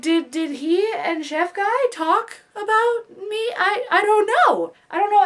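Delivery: high, slow, whimpering panic; panicked whining. Retold or imitated. Wondering if coworkers gossiped, panicked high whimper. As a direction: whine it like you're about to cry. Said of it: "And I'm like".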